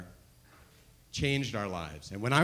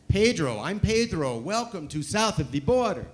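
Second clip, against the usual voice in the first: Pedro billboard voice; 6 semitones higher, 6 dB louder than usual. Retold or imitated.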